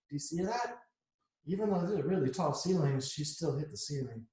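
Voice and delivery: ordinary delivery, nothing marked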